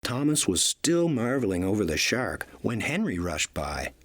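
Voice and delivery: plain reading voice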